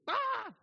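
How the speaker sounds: very high, loud squeal for the puppy; excited puppy yelp. Retold or imitated. Pastor voicing the puppy's surprise, very high loud squeal. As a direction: squeak like a startled, thrilled puppy.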